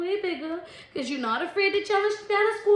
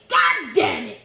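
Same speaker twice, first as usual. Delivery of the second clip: mock exclamation; 2 semitones higher, 9 dB louder, slower than usual. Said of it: "I hear somebody saying".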